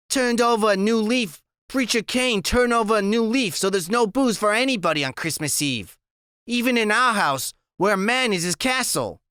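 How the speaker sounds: high sarcastic woman's voice; high tipsy sarcasm aimed at the preacher father; Loretta, drunk and mocking. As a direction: sneer it out high, tipsy and bitter.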